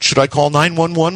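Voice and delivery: high-pitched, lightly voiced daughter; eldest daughter. A narrator's reading. Daughter asks dad about emergency help, lightly voiced. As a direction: lightly voice a girl by raising the pitch. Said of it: "my eldest daughter wanted to know".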